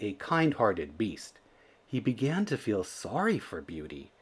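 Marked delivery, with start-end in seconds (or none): none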